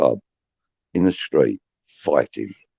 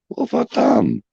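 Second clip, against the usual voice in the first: high-pitched acted bewilderment; protesting innocence. He answers the upset girl beside him, mock innocent.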